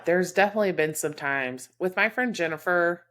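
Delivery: usual speaking voice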